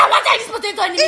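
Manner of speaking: fast, loud, high comic refusal; rushed high-pitched mock protest; mock outburst. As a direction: burst out in hammed-up exasperation. Acted outburst in restaurant story.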